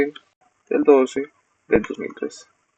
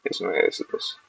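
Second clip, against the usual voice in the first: barely voiced, muttered to himself while copying a number; whispered to himself; 4 semitones lower, 11 dB quieter than usual. Real, not acted. Presenter checking a number alone, whispering under breath.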